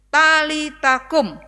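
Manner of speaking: slow, loud command. Acted. Jesus commanding the girl, slow and loud. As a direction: call the girl to rise, slow and strong. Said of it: "Yesus memerintahkan".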